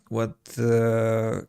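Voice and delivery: ordinary talk, nothing marked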